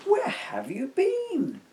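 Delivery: high slow questioning mum voice; mothers asking. Acted. Mothers question their children, high and slow. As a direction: draw it out like a curious mum.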